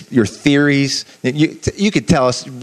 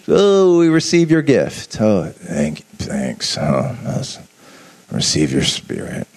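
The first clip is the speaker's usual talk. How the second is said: unenthusiastic believer; 6 semitones lower than usual. Pretend. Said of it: "We're not just".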